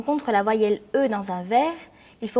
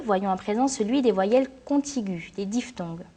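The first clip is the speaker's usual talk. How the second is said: usual speaking voice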